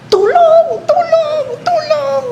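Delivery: high panicked shouts of help; hammed-up high cry for help; yelling for help. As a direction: scream for help from the overturned raft.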